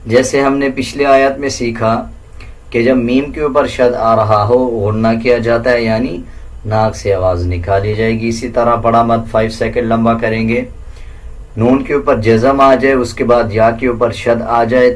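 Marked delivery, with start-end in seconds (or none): none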